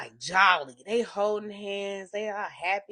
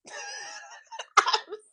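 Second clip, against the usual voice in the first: high incredulous laugh. Real, not acted. Laughing at the scene.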